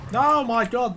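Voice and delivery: loud, high exasperated cry; real frustration bursting out; frustrated outburst. Real, not acted. Frustrated at bad aim.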